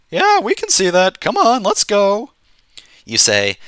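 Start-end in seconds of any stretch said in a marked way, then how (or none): 0.1-2.3 much higher-pitched guard voice; guard character. Acted. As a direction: play the guard up high. Guard voice answers the player.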